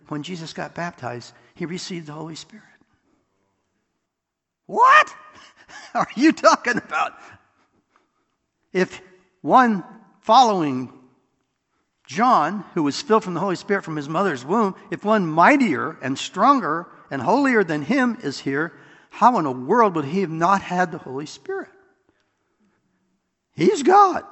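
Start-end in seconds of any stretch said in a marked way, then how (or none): 4.7-7.1 mock the idea as absurd; incredulous scoff. Preacher scoffing at people who teach the baptism view, loud and incredulous. 8.7-21.7 higher, louder pulpit argument, pressing the point; preaching at full force. Real, not acted. Preacher arguing with the congregation, loud and insistent. 23.5-24.2 much higher and louder, a ringing proclamation; emphatic declaration. Real, not acted. Preacher settling the argument for the congregation, loud.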